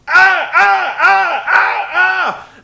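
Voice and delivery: high, loud hammed-up raging yells; mock rage cries. Cole's meltdown on air, high hammed-up raging yells. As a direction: scream in cartoon frustration.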